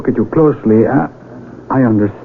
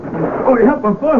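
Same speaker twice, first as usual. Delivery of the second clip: sudden high alarmed cry while falling; startled cry for help; 12 semitones higher than usual. Acted. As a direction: yelp for help, voice jumping up as you tumble. Falls in the dark cave.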